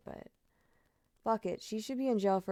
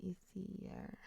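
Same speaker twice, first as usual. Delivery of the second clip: whisper; 15 dB quieter than usual. Real, not acted. Whispered name aside.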